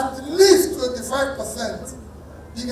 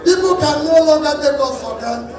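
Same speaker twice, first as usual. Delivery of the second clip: raised, loud and higher rally cry; rally shout; 4 semitones higher, 4 dB louder than usual. Real, not acted. Politician rouses supporters about the country's future, shouting. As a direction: shout it out to the crowd. Rally speech climax, crowd.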